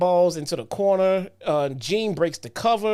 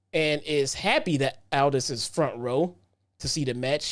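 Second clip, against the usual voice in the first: ordinary talk, nothing marked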